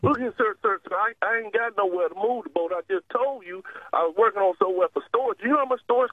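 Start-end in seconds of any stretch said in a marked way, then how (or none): none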